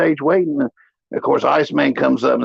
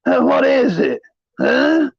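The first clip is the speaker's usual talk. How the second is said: higher, bewildered questions; mock confused stoner, voice pitched up; dazed confused mumble; 10 semitones higher, much slower than usual. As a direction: imitate a spaced-out guy snapping back. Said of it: "He's like".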